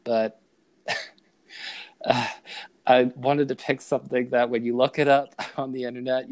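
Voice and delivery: normal talking voice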